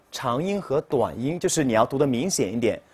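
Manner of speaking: ordinary delivery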